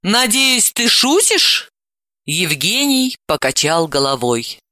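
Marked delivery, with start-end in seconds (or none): 0.0-1.7 rising disbelief, higher; hoping it's a joke; incredulous. Acted. Aunt hopes it's a joke.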